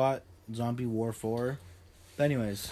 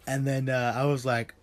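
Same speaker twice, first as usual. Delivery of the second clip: normal talking voice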